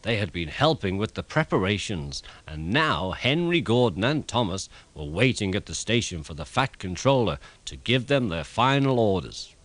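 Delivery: usual speaking voice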